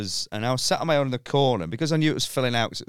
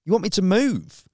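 higher, louder incredulous question; disbelieving, a touch louder; mock disbelief; 7 semitones higher, 4 dB louder than usual. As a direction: act out her stunned reply. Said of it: "I went".

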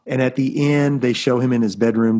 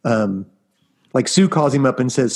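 usual speaking voice

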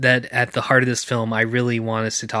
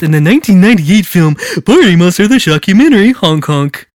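wacky clown voice; 2 semitones higher, 8 dB louder than usual. Acted. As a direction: honk it out like a goofy clown. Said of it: "in my wacky clown voice".

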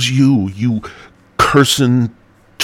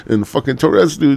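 ordinary talk, nothing marked

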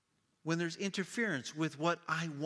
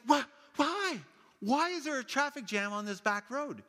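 high, incredulous wail; can't believe it, again?; outraged disbelief; 11 semitones higher, 4 dB louder than usual. Retold or imitated. Mom hitting a jam on the back road, high disbelief. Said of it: "And she's like".

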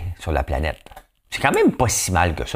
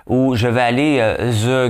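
ordinary talk, nothing marked

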